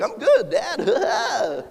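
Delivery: put-on high, slow, giggly little-boy voice; cheerful seven-year-old son. Son answers his dad, high and giggling. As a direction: play the clumsy son, laughing it off. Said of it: "and he's like".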